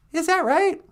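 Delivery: very high, loud questioning voice; high-pitched cartoonish reply; squeaky sidekick. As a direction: pipe up as a tiny sidekick.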